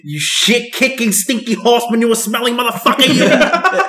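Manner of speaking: high, drawn-out mock tirade; hammed-up gangster insult, rising at the end; Joe Pesci. Fan quoting a favourite film insult, high and mock-angry. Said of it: "to quote Joe Pesci at that scene".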